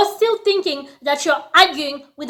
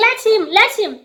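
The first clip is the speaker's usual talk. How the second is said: defiant dare; 7 semitones higher, faster than usual. Retold or imitated. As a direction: brush off the threat with bravado. Defiant retort.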